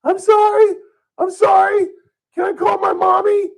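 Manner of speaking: put-on very high, loud little-kid voice; imitated scared kid. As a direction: mimic a child pleading in squeaky falsetto. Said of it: "all of a sudden you hear this voice".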